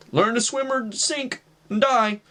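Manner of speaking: a brother's mock sink-or-swim challenge; mock tough love. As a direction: draw it out, imitating a hard-love brother. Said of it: "just giving me tough love".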